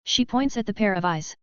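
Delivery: plain reading voice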